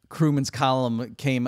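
ordinary talk, nothing marked

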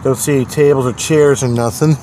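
ordinary delivery

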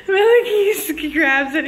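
telling the punchline while cracking up; real laughter. Real, not acted. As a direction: tell it through giggles you can't hold. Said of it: "now I'm laughing even harder".

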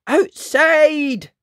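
high, loud warning shout; alarmed yell. A narrator's reading. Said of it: "Missus Jones yelled".

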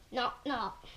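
two soft, short imitated knocks; door knocking. Reader showing a hand on a door, soft knocking. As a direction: knock softly with the voice. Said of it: "like a hand on a door".

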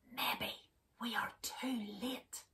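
hushed, worried whisper; whispering Bear. Acted. Bear whispering his fear at Duck's door. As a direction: breathe it out, afraid it's too late. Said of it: "whispered Bear".

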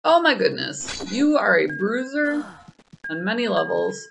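normal talking voice

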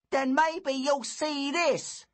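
high slow loud threat; a bully promising a punch; menacing bully. A narrator's reading.

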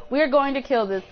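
ordinary talk

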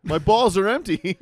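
high, loud plea; mock desperation. Man pleading with a toilet, high mock-desperate yell. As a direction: cry out in pretend exasperation.

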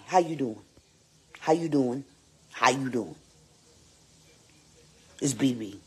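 usual speaking voice

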